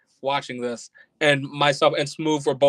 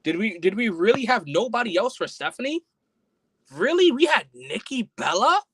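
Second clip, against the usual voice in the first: incredulous; 7 semitones higher than usual. Real, not acted. Disbelief over the match booking.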